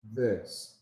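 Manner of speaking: "this" with the tongue tip tapping the upper teeth; "this" softly, tongue tapping behind the upper teeth, not sticking out; tapped voiced th. Shown as a demonstration. Teacher showing how to tap the teeth on "this". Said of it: "like this".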